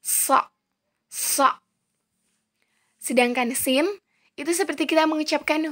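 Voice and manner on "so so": So with the back of the tongue raised; emphatic sad. Shown as a demonstration. Teacher shows how the letter is formed, heavy emphatic So. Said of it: "bagian pangkal lidah naik sedikit".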